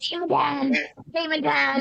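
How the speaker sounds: duck voice. Pretend. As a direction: chant it like a waddling duck mascot. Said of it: "I just imagine this duck walking around".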